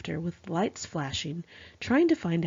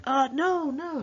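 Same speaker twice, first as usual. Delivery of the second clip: making excuses; 9 semitones higher, slower than usual. Retold or imitated. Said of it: "trying to make excuses".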